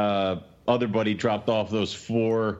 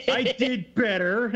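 proud; 11 semitones higher, slower than usual. Real, not acted. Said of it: "I corrected myself".

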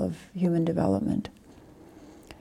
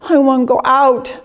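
put-on high wailing child's sob, much higher and louder; crying toddler; 7 semitones higher, 5 dB louder than usual. Dharma teacher imitating a crying toddler at the door. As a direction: wail it like a sobbing two-year-old. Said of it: "she's crying".

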